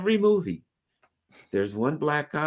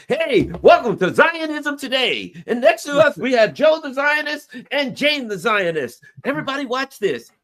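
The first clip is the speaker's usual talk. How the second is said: high, loud mock TV host; hammed-up show opening, pitched way up; news show host; 9 semitones higher, 5 dB louder than usual. Host introducing guests to viewers, mock news show host. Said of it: "So she puts together".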